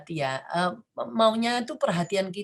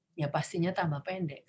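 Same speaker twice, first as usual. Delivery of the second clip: usual speaking voice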